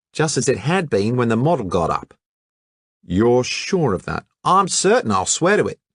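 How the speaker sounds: breezy colonial lad; Hatchett's Australian voice. Acted. Hatchett answering the detective casually, brash Aussie voice.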